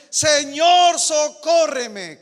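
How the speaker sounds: loud, high, slow cry for help; woman kneeling, crying to the Lord; loud pleading. Acted. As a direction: beg from your knees, loudly. Kneels and begs.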